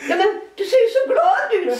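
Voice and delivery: high laughing retort; laughing quote. Retold or imitated. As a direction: blurt it out in amused disbelief. His own amazed retort.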